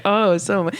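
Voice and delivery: ordinary delivery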